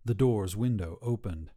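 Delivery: plain reading voice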